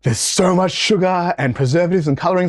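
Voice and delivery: high, wide-eyed amazement; awed kid. Retold or imitated. Boy marvelling at the sugary food, in a high awed gush. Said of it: "I'm like".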